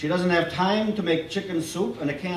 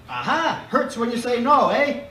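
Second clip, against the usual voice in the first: high, loud gotcha; triumphant doctor; 8 semitones higher, 5 dB louder than usual. A narrator's reading. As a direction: catch him out cheerfully. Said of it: "talks fast and funny".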